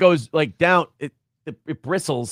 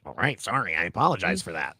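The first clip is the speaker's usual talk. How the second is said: low, soft, sheepish mumble; apologetic Nick impression; 10 semitones lower, 8 dB quieter than usual. Nick apologizing after the crude line, low and soft. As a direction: drop deep and quiet to apologize. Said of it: "Gotta work on my Arcade impression".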